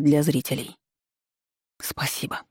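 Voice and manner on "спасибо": breathy grateful whisper; whispered thanks. A narrator's reading. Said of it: "с огромной благодарностью прошептала Ника".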